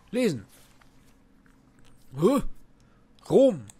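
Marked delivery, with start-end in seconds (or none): none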